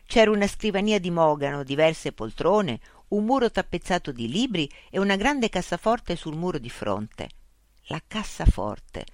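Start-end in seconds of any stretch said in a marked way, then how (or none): none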